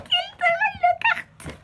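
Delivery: Gilderoy Lockhart, stretched out in a very high excited squeal; overjoyed. Real, not acted. Youtuber naming her favourite pop again, very high slow squeal. Repeats the name even more thrilled.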